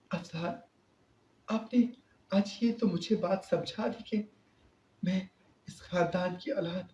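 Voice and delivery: put-on high, soft pleading girl's voice; tearful young wife. A narrator's reading. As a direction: beg him through tears, voice lifted high. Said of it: "Rabia نے روتے ہوئے".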